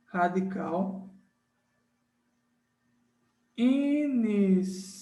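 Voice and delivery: each word drawn out with long gaps, slow; slow dictation. Shown as a demonstration. Teacher dictating the rule to students, slow and spaced. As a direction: dictate it slowly so the class can copy. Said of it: "Prefixo mais radical iniciando por vogal".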